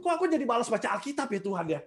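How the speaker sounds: hurried whiny plea to God; mock complaining prayer. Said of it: "larinya tuh ke Tuhan". (mengeluh kepada Tuhan dengan cepat dan merengek)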